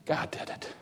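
unvoiced hush of an answer; soft reverent whisper. Shown as a demonstration. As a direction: breathe it, no voice. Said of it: "repeated answer, whispered".

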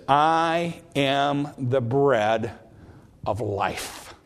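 I am the bread, drawn out very slowly with weight on I; emphatic I. Shown as a demonstration. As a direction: lean hard on I, then let life fall away. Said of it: "a Greek emphatic".

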